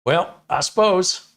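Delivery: put-on high, loud, drawn-out Midwest accent; slow mock Upper Midwest sigh-off; Midwest goodbye. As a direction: stretch it out as a cue to leave. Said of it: "when you go to leave, you go".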